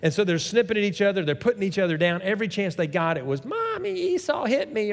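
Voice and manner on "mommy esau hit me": put-on high-pitched tattling little kid; whiny child. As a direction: tattle to mommy like a small child.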